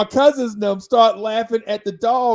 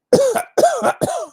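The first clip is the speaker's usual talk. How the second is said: high, loud hacking cough as the dog; mock dog cough; imitated dog cough; 7 dB louder than usual. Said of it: "the dog cough".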